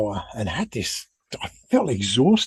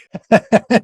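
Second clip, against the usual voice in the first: real laugh; 11 semitones higher, 10 dB louder than usual. Real, not acted. Laughing at his gag reflex.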